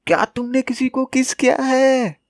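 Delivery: high, shouted question; a nervous boy shouting a question at the girl; blurted shout. Retold or imitated. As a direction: blurt it out at the top of the voice. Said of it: "चिल्ला के बोलता है".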